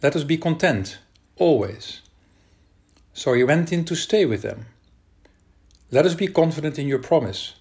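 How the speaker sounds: ordinary talk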